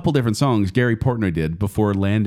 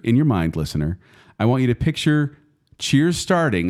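ordinary delivery

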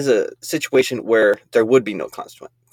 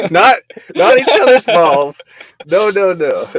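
louder, higher, slowed by laughter; laughing; 8 semitones higher, 5 dB louder, slower than usual. Real, not acted. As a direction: blurt it out while laughing. Laughing correction after joke.

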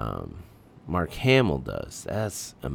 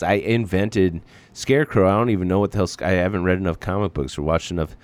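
ordinary talk, nothing marked

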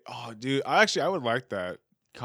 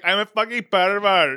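put-on louder, higher Nepalese accent; Subas impression; 7 semitones higher, 10 dB louder than usual. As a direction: belt it in a mock Nepalese accent. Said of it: "whenever I think of Subas's accent".

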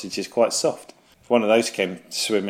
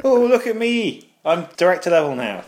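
higher and louder, boasting quickly; smug show-off; 7 semitones higher, 3 dB louder than usual. Pretend. Successful friend bragging to his mates, smug and loud. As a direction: crow about the new promotion.

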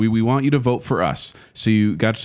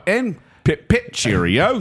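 mock British accent; 6 semitones higher, slower than usual. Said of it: "we do the accents every time".

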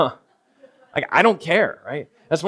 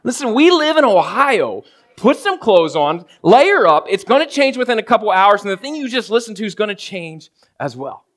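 exasperated rant; 7 semitones higher, 4 dB louder than usual. Retold or imitated. Pastor retelling his fed-up reply to his wife, high and loud. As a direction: exaggerate your own fed-up retort. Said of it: "I'm like".

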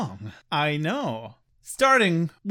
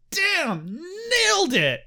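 excited praise; 11 semitones higher, slower than usual. Retold or imitated. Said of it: "I'm like".

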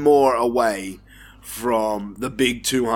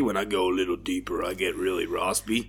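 dropped low and softer, rasping; low raspy voice, a good deal softer; deep raspy voice; 5 semitones lower, 7 dB quieter than usual. Shown as a demonstration. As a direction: sink into a low rasp to sound sexy. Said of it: "You're getting, getting real deep and shit".

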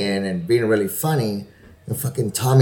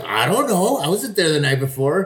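high sheepish guy; 6 semitones higher than usual. Retold or imitated. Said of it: "He was like".

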